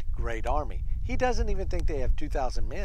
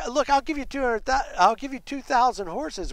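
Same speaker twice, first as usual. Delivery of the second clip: higher-pitched mocking paraphrase; mocking taunt; 9 semitones higher than usual. Commander re-offering horses to a weaker army, mocking. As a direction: toss out the offer with casual scorn. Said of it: "He is so mocking. He's so ugly".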